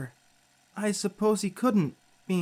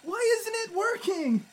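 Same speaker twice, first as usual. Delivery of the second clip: panicked; 12 semitones higher, slower than usual. Acted. Panic, powers failing.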